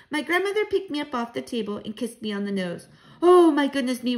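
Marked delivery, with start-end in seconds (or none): 3.2-4.2 loud, high outburst that falls away; exclaiming. A narrator's reading. Grandmother marvels at the work ahead, crying out. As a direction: burst out loudly, then settle. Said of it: "she cried".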